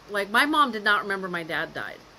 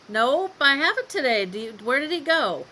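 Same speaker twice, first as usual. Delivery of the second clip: higher, acted innocent reply; playing along; 5 semitones higher, 4 dB louder than usual. Daughter plays along with mom's question, feigned innocence. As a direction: feign not knowing, pitch easing down.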